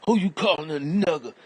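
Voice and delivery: high, loud angry threat; shouting back at the insult, full fury; furious yell. Acted. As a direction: bark it at him ready to fight. Acted street confrontation.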